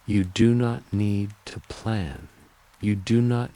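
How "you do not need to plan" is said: ordinary delivery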